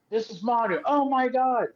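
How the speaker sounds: high-pitched, thrilled gasp of recognition; excited recognition. Retold or imitated. Wife meets the wrestler she heard about, imitated excitement.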